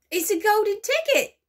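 shrieking with delight; screaming. Acted. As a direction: scream it out in shock. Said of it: "screamed the shopkeeper".

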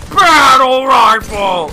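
long, loud, high yell; slow, loud exasperated holler; outraged shout. Real, not acted. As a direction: bellow it drawn out. Outburst at being shot.

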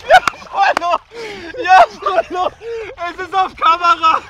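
high, loud, laughing in the cold; laughing shouts. Real, not acted. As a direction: whoop and laugh through the chill. Shivering laughter in cold water. (lachend, hoch und laut)

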